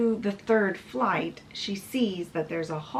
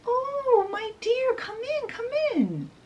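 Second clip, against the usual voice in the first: put-on very high, cooing old-lady voice; old lady's welcome. Old lady greets the lost princess, high welcoming voice. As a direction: welcome a lost child in a high, fussing sing-out.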